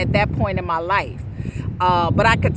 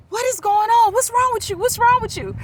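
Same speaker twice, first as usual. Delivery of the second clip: much higher, louder alarmed cry; alarmed friends; 13 semitones higher, 3 dB louder than usual. Retold or imitated. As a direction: rush in asking what happened. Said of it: "came rushing into the living room like".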